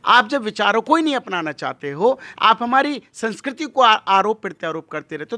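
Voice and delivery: normal talking voice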